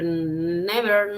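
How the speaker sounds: ordinary talk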